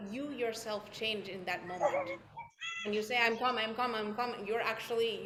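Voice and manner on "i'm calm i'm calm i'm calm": quick, run-together repetitions; hasty mantra that contradicts itself; hurried, not calm. Shown as a demonstration. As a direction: rush it so it sounds unsettled. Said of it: "you know you're not calm".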